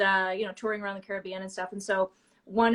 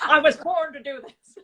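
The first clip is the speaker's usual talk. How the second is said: higher and louder, laughing; mock grand; 7 semitones higher, 8 dB louder than usual. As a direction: proclaim it like a born star. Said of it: "mocking the kid's thrill".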